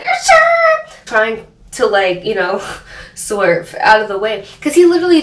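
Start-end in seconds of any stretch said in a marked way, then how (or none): 0.0-0.9 very high, louder squeaky sure; mock squeaky sure. Narrator reliving the swerve, squeaky high sure. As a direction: yelp it like a startled cartoon. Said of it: "And I was like".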